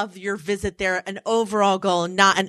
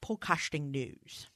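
a hint of a British anchor, softer, on "Podcasting news"; lightly British; 6 semitones lower, 15 dB quieter, slower than usual. Shown as a demonstration. Host re-demoing the line for cohost, faintly British. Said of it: "It's British a little bit".

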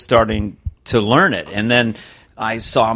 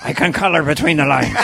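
put-on toddler with an action hero's Irish voice; grave, put-on Irish greeting; Liam Neeson; 3 semitones higher than usual. Youngest son answering dad, Liam Neeson voice. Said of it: "Liam Neeson".

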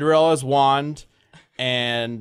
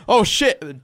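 raised, startled curse; mock alarm; 6 semitones higher, 4 dB louder than usual. [name] seeing Aurora wake, mock-alarmed. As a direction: curse as if caught out.

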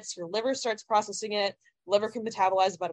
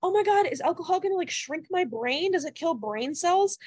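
mock fearmongering panic; 9 semitones higher than usual. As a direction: imitate people panicking, pitched way up. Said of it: "fear mongering once again of people like".

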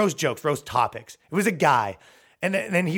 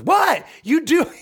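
high, loud indignant shriek; indignant what; 8 dB louder, slower than usual. Retold or imitated. Girlfriend reacting to being shushed, high loud indignant outburst. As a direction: squeal it out, outraged.

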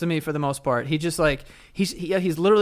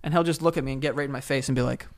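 ordinary delivery

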